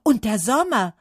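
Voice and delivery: loud, high, excited shout; calling out. A narrator's reading. Trees turning to praise Summer, calling out. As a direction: shout it out with enthusiasm. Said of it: "riefen die Bäume".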